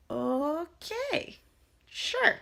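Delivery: high, drawn-out sceptical agreement; mock-polite going along with it; humouring her. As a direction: stretch it out, placating and doubtful. Couple humouring eccentric mother-in-law.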